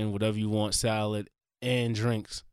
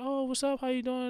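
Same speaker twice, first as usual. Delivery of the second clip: high, soft imitation of chatty girls; friendly girls' greeting; 13 semitones higher, 4 dB quieter than usual. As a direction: greet like a girl approaching tourists. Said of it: "Two girls were talking to us".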